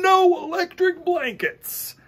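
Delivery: high, loud farmer saying no; Farmer Brown refusing. Acted. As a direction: plant your feet, flatly refuse.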